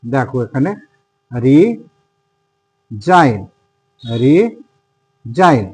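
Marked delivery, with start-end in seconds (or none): 1.3-5.6 রিজাইন said very slowly as ri-zain, no g sound; silent g demo. Shown as a demonstration. Teacher modelling the word for students, very slow and careful. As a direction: drag out রিজাইন with the g left silent. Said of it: "জি এর পরে যদি N থাকে তাহলে কিন্তু জি এর উচ্চারণ হবে না".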